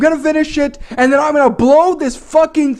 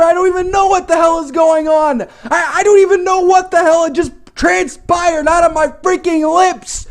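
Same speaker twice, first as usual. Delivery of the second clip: ordinary delivery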